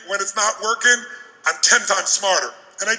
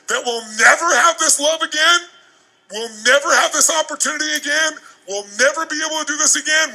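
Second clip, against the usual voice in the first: impassioned; 6 semitones higher than usual. Real, not acted. Preaching about loss.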